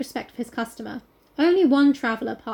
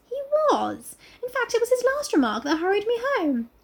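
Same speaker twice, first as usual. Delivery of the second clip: high-pitched character reply; much higher acted dialogue; voiced heroine; 10 semitones higher than usual. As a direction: lift the voice high for the young woman. Reading Mary's reply in dialogue.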